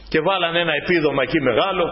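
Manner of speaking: normal talking voice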